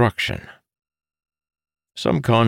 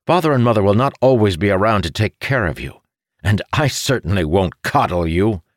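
higher, louder indignant lecture; scolding brother; 6 semitones higher, 4 dB louder than usual. Acted. Brother lectures his irresponsible sibling, scolding. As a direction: lay into your brother with emphatic reproach.

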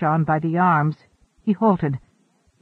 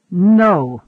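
firm interruption; 3 semitones higher, 6 dB louder, slower than usual. A narrator's reading. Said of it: "Wanderer interrupted".

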